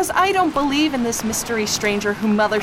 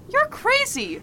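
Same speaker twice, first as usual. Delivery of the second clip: incredulous outburst; 11 semitones higher, slower than usual. Acted. Maggie protests detective's refusal.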